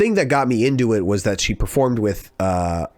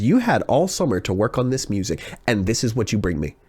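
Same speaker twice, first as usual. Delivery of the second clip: ordinary talk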